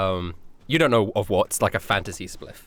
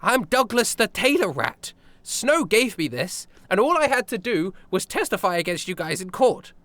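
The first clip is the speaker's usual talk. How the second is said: eager tailor rat; 8 semitones higher than usual. Acted. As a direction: announce your new self with pride. GM voicing Douglas the tailor rat.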